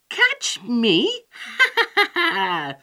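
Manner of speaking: high mocking question into a cackle; laughing. A narrator's reading. Gingerbread man mocks his chasers, laughing. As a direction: scoff loudly, then burst into ha-ha laughter. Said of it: "laughed the gingerbread man".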